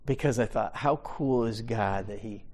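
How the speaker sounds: ordinary talk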